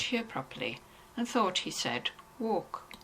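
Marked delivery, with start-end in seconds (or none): none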